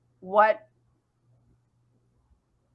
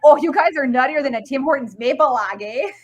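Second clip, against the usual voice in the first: broad Canadian valley accent, louder; 6 dB louder than usual. Shown as a demonstration. Podcaster showing friends how they talk up north, valley accent. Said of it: "I start developing a valley accent".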